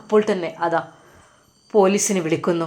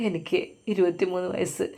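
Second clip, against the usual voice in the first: a soft, beaten-down answer, much quieter; timid reply; 8 dB quieter than usual. A narrator's reading. Arrested young man answers the policeman, softly and meekly. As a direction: murmur it like a frightened young man.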